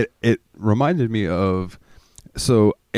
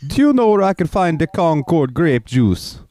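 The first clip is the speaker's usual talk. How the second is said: higher-pitched Jamaican lady imitation; Jamaican accent; 9 semitones higher than usual. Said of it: "I love the Jamaican accent".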